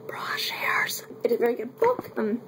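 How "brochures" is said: breathy whisper, trying out the name; hushed, unsure whisper of Brochures; whispered guess. Real, not acted. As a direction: whisper the word like a doubtful aside. Guessing author's name, gives up.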